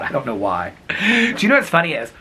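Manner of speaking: usual speaking voice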